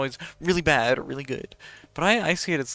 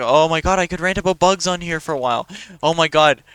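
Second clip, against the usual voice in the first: excited gushing; 3 semitones higher, 7 dB louder than usual. Real, not acted. Gushing about bugs, can't stop.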